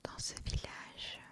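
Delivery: ordinary delivery, nothing marked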